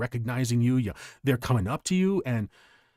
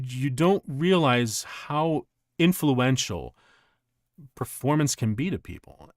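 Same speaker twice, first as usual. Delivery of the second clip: ordinary talk, nothing marked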